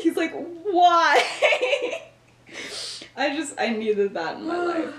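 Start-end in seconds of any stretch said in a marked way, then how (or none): 0.3-2.0 high-pitched mock disbelief, breaking into laughter; hammed-up incredulous "why". Retold incredulous reaction to crush reveal.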